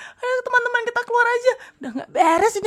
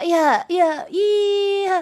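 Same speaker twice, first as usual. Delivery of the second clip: ordinary talk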